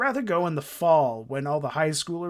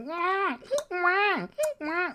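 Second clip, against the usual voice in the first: put-on high wailing wah like a crying baby; beetle cry imitation; much slower than usual. Streamer mimicking Japanese summer beetles, high wailing. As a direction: whine it out like a newborn. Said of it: "tree beetles that are just like".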